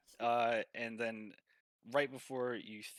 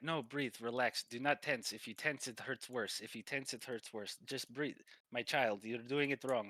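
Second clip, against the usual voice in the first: firm instructing Russian masseur; Russian-accented masseur; 2 semitones higher than usual. Acted. Masseur urges a tensed-up client to breathe, Russian accent. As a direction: coach them through it in a Russian accent. Game master plays the masseur.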